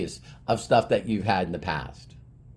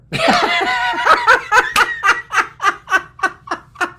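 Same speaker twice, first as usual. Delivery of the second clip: long high-pitched laughing fit; loud, high, tapering laughter; big laugh; 5 dB louder than usual. Real, not acted. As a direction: laugh hard at your own punchline. Laughs at own punchline.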